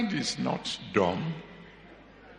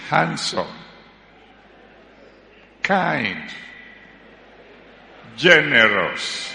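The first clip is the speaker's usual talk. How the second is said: praising each word louder and slower; mock fervent prayer; 3 semitones lower, 9 dB louder, slower than usual. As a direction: build up the praise word by word, slowing. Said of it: "Just begin to pray and say".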